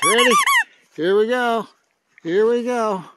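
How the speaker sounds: high, loud game call; excited play call. Real, not acted. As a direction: rouse a pet for the next throw. Rousing the pet to fetch.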